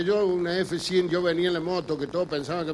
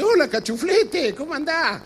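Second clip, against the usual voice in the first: put-on old man voice; 9 semitones higher than usual. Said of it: "y me dice".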